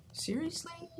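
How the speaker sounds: high, soft disbelieving seriously; a stunned gasp of disbelief; shocked reaction. Retold or imitated. Teacher's silent reaction to her angry student, high and soft. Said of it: "I didn't say that to him".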